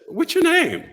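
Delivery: very high squeaky question; high-pitched mock hysterics; hysterical panel member. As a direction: ask as if helpless with laughter. Hysterical panel asks through laughter.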